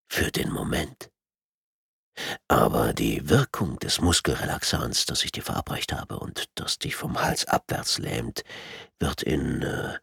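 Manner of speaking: low, hushed clinical menace; low-voiced, understated threat; calm sadistic tormentor. A narrator's reading. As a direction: explain it softly, half whispered, like a cold torturer. Villain explains the drug's effect.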